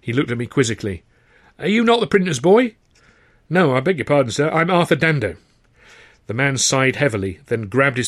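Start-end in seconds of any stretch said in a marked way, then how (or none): 1.6-2.8 high, loud, exasperated boss; impatient manager. Acted. Manager questions the lad. 3.5-5.4 a touch higher, politely earnest; polite young man. Acted. Young man introducing himself.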